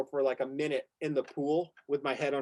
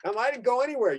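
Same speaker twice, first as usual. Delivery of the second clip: lightly voiced carefree buddy, a bit higher and louder; imitating a nonchalant friend; 6 semitones higher, 5 dB louder than usual. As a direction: toss it off like nothing happened, mock innocent. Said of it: "I remember you were like".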